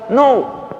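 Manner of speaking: high, loud, fervent exhortation; fired-up preacher at full volume; full-force preaching. Real, not acted. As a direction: urge the congregation with all your force.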